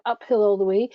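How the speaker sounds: usual speaking voice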